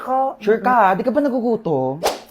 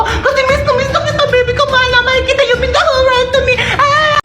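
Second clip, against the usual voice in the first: hammed-up, very high loving squeal; gushing baby talk; 14 semitones higher than usual. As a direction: coo and squeal with overflowing affection. Gushing over a beloved baby. (OA at matinis na paglalambing)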